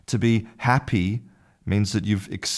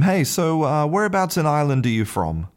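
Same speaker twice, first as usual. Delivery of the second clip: high friendly Irishman; 8 semitones higher than usual. Acted. Irishman voiced asking in joke.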